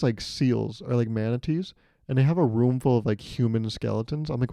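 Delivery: usual speaking voice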